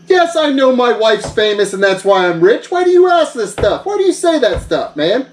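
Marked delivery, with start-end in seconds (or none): 2.7-5.3 offended whine. Acted. Yoshi teases him about wife.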